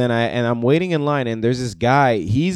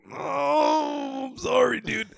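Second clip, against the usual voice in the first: high, soft mock wail; apologetic wail; 14 semitones higher, 6 dB quieter, much slower than usual. Apologizing to a friend who wanted a nap, high apologetic wail. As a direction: draw out a guilty, squeaky apology. Feels terrible asking napping friend.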